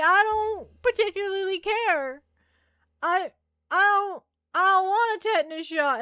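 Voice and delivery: retelling refusing a shot at the doctor, whiny; whiny refusal. Retold or imitated. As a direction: plead like a kid refusing a shot. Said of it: "And I'm like".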